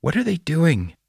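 put-on high-pitched, alarmed child; near panic. A narrator's reading. Girl in the car watches the figures outside, near panic. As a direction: ask it like a child on the edge of panic. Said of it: "Dora was on the verge of panic".

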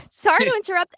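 usual speaking voice

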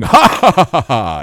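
jovial booming laugh. Retold or imitated. Storyteller imitating a drunk stranger's laughter, jovial and booming. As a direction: boom out a mock jovial "ha ha ha". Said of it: "completely jovial and laughing".

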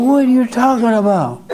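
high-pitched imitation of indignant women; mock outrage. Shop women challenging the man's remark, in high mock outrage. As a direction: squawk the challenge in a put-on high voice.

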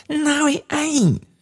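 very high, drawn-out squeal of denial; burst of delight. A narrator's reading. Daughter repeats her denial to father, delighted. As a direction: stretch it out high in glee. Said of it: "cried Meg in a burst of delight".

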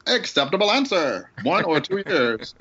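high vintage quizmaster announcer; old-time announcer. Pretend. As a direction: rule on it like an old radio host. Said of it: "my old time announcer voice".

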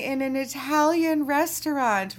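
high-pitched, incredulous protest; retold exasperation, pitched well up; exasperated disbelief. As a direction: act out being baffled at the whole table. Acts out exasperated reaction at table.

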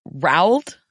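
Rowled with an "ow" vowel, as a question; Rowled as one questioning syllable; sounding out spelling. Shown as a demonstration. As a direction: try Rowled rhyming with howled. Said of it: "he writes it".

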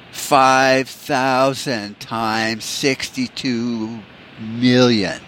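very drawn-out numbers, word by word; slow quizzing kid. Retold or imitated. Son testing dad with big numbers, very slow and deliberate. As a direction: stretch each number as if inventing it.

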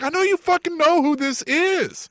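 high, drawn-out incredulous retort; exasperated retort. Retold or imitated. Fan answering commentators who feign ignorance, exasperated retort. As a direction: pitch it up in mock outrage.

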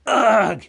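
loud, high yelp of disgust; startled groan of revulsion; disgusted "ugh". Acted. Fish lands on Bilbo's toes.

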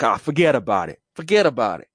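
put-on high New York mobster accent; New York forget about it. Mobster brushing off an apology, New York accent. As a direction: wave it off like a wiseguy. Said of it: "I'd be like".

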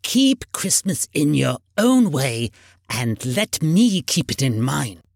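put-on slow, stern old uncle; measured, crotchety rebuke. A narrator's reading. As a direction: lay down the law slowly and firmly. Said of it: "returned the uncle sternly".

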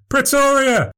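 "Pretoria" sung out very high and falling; triumphant flourish. Acted. Showman triumphantly reciting capitals.

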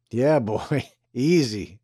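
laughing mockery of easy, boy; mocking laugh. A narrator's reading. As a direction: laugh the word easy as a taunt. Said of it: "said Dance, laughs".

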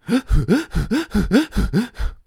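put-on high, loud excited toddler gasp; hyperventilating gasp. Toddler overwhelmed by the car cart, mock hyperventilating gasp. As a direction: gasp for air like an overexcited toddler. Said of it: "starts si-, hyperventilating".

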